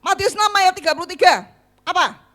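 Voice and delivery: loud, fast demanding quiz; stern leader testing a student; strict leader quizzing. Retold or imitated. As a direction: fire the verse at her. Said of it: "Setiap kali ditanyain".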